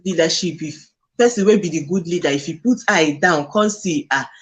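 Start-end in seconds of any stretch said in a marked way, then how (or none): none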